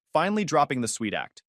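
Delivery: usual speaking voice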